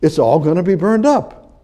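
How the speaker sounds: high, loud pulpit preaching; high-pitched impassioned sermon voice; fervent preaching. Acted. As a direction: preach it hard to the congregation. Preaching with raised voice.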